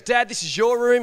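excited son pointing out the cabin; boy thrilled at the boat show; excited kid. Retold or imitated. Son excitedly showing boat rooms.

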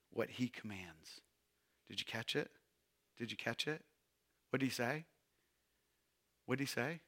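soft, low questions; hushed. Real, not acted. Preacher softly quizzing the congregation on the verse. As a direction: ask them quietly, drawing them in.

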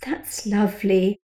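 slow, forced pleasantness; plastic tone. A narrator's reading. Rachel answering the child in a forced tone, slowly. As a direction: sound nice without meaning it. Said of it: "not too much of a plastic tone".

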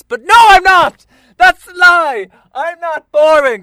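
high, loud protest; loud, high indignant yell; indignant outburst. Real, not acted. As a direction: shout the denial. Outburst correcting himself.

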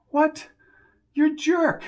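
high, loud incredulous What; outraged disbelief. Acted. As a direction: jump up in disbelief, then scorn.

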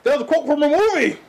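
squeaky-high stammered excuse, mocking. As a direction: imitate someone fumbling for an excuse. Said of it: "they're gonna think that they can say it too".

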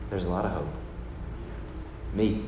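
gentle, smiling Jesus; smiling reassurance. Retold or imitated. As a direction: say it softly, smiling. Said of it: "Jesus might have smiled back".

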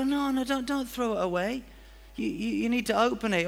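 high, urgent plea; pleading giver. Retold or imitated. Giver begging the recipient not to bin the gift, high and urgent. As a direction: beg them not to throw it away.

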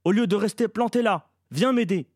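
loud, high urgent rebuke; forceful vociferation. A narrator's reading. Boy orders Eleanor to help him, shouting. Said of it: "vociféra-t-il avec force".

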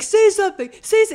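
high, loud imitated goading; hammed-up hothead picking a fight; mock threatening. Imitating him about to punch cyclist, mock threatening. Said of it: "He's going to punch him".

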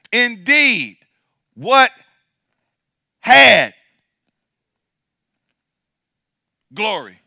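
each word shouted alone with long pauses between; drawn-out emphasis. Acted. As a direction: hold a long pause after every word. Scripture phrase split by long pauses.

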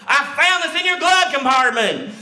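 high, strained mock yell; hammed-up patrolman bellow; accusing officer's shout. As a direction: shout the accusation like an outraged cop. Patrolman's angry accusation, acted.

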